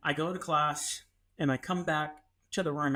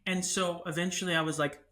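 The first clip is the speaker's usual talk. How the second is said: ordinary talk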